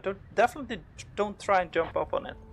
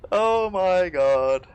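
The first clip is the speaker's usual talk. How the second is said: drawn-out relief; 6 dB louder, much slower than usual. Real, not acted. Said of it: "That's absolutely crazy".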